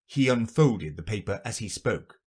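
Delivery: plain reading voice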